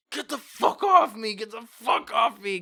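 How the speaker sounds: high, loud mock yell; loud, high-pitched retold protest; retold angry shout. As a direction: imitate a man yelling to be let go. Retold man shouting in car.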